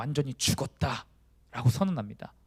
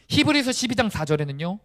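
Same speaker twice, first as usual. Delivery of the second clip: ordinary talk, nothing marked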